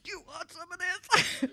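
high-pitched put-on stepdad voice; stepdad imitation. Imitating stepdad offering guests some, high put-on voice. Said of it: "he would be going like".

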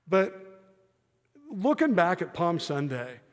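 loud, high, driving sermon voice; impassioned preaching. Acted. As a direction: preach it hard to the congregation. Preaching at full force.

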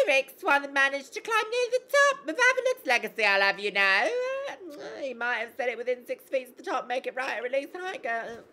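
loud, high-pitched character voice; boastful game character. Acted. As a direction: play it big and high, then ease off.

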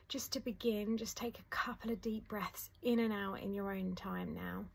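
soft, unhurried meditation voice with long pauses; guided meditation. Acted. Teacher guiding listeners through a letting-go meditation, soft and calm. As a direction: ease the listener gently into calm.

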